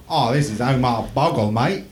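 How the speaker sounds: put-on a jokey, lightly done Australian accent; mock Australian accent. Podcaster imitating an Australian YouTuber, mild Australian accent. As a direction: put on a cheeky Aussie accent like a mate. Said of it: "That's my Australian".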